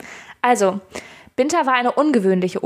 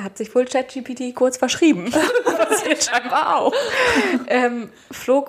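laughing; 3 semitones higher than usual. Real, not acted. Laughs at ChatGPT typo.

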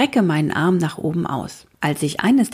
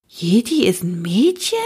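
disbelieving; 2 semitones higher than usual. A narrator's reading. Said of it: "fragt Vera ungläubig".

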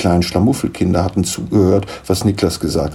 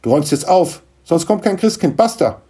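high, emphatic scolding; stern ultimatum; 11 semitones higher than usual. Acted. Mother threatens Niklas with no Christmas presents, scolding. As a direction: put your foot down, rising in pitch. Mother gives final order.

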